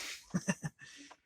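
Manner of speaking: short high, airy chuckle; laugh. Real, not acted. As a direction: chuckle at your own impression. Laughs after own impression.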